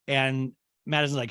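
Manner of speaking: ordinary talk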